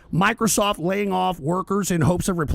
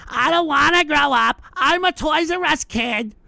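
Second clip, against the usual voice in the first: mocking childish whine; 8 semitones higher, 4 dB louder than usual. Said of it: "So they can go and".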